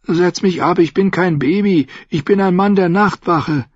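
put-on a frightened boy insisting he's a man; weak whiny protest. A narrator's reading. Sam begs Paul to put him down, high and pleading.

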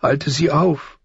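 put-on high-pitched girlish plea; pleading. A narrator's reading. Sansa begging her father to intervene, pleading. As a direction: beg for help in a high voice. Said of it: "flehte Sansa".

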